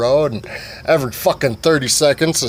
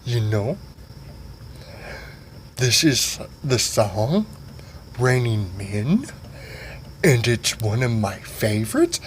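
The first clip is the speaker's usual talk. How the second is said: soft swoony voice; 4 semitones lower, 7 dB quieter, slower than usual. Retold or imitated. Tonga softly, slow.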